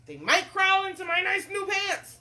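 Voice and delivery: high, hammed-up fretting; nervous cook. Cook fears worms in his pants, acted nervousness. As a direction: squeal with storybook worry.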